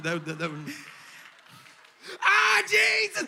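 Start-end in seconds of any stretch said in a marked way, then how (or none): 2.2-3.3 high, drawn-out mock scream; demonstrated scream. Shown as a demonstration. Preacher showing the translator how to do it, high scream. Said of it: "you gotta do better than that".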